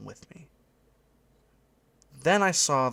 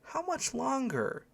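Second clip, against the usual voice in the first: put-on high, drawn-out child's whine; whining boy; 4 dB quieter, slower than usual. A narrator's reading. Boy to his dad, high slow whine. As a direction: whine it slowly, like a bored kid. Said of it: "I could hear the boy whining to his dad".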